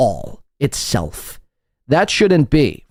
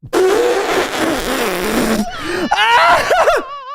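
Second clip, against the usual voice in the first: shrieking laugh. Real, not acted. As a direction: crack up in a high shriek at his rant. Cracks up at the clip's rant.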